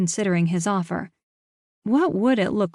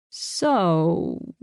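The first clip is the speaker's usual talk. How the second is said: drawn out. A narrator's reading. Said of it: "drawing out the word".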